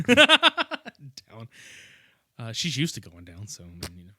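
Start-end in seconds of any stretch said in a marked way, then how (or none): 0.1-1.5 high laughing shout. Real, not acted. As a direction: burst out laughing on it. Laughing pun.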